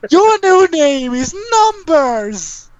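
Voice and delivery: high, drawn-out proclamation; shouted character voice. Acted. Character announcing a new name for Ryan, high slow shout. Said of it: "He's go".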